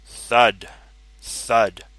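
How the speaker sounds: loud, booming footstep sounds; heavy thud. A narrator's reading. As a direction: make each thud land heavy. Dinosaur footsteps approaching.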